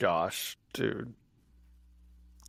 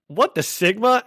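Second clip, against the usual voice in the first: imitated kindergartner; 9 semitones higher, 3 dB louder than usual. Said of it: "there's, like, this kindergartner who".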